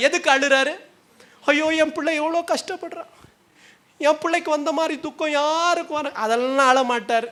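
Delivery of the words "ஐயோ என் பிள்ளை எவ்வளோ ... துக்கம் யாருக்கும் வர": high-pitched acted wailing complaint; mock parental lament. Parent frets over a suffering child, in mock lament.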